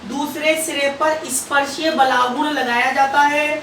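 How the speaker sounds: slow, measured dictation with long pauses. Acted. Teacher dictating notes slowly to students, slow and measured. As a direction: spell it out word by word for note-taking.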